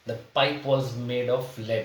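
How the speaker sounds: slow, word-by-word dictation of the sentence; slow example reading. Shown as a demonstration. Teacher reads the example sentence slowly to students. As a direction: read it out slowly for the class to follow. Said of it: "reads example sentence slowly".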